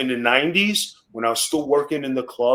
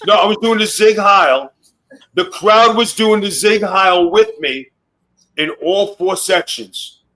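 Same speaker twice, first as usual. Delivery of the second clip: louder, higher, building excitement; excited; 6 semitones higher, 7 dB louder than usual. Real, not acted. Wrestler telling podcast hosts about the crowd, excitedly. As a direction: hype up the memory, voice climbing.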